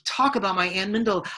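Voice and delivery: ordinary delivery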